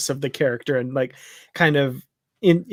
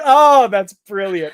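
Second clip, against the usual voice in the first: delighted; 5 semitones higher, 6 dB louder, slower than usual. Retold or imitated. Delighted retold reaction.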